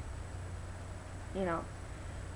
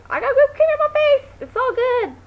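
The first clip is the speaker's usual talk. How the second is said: high, loud mock-celebration squeal; excited yelling; 9 dB louder than usual. Acting out her reaction to being creamed, excited yell. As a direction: shout it gleefully to the room. Acts own reaction to the prank.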